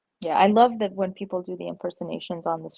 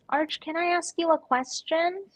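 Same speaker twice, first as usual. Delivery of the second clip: high, questioning put-on voice; pitched-up mimicry, rising at the end; Curly Q two impression; 9 semitones higher than usual. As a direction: imitate a streamer pestering someone. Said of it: "He's always saying that".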